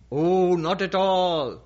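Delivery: drawn-out, slow bull voice; big easygoing bull. Acted. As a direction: stretch it out like a big, unbothered bull. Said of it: "there's a bull like that, a big one".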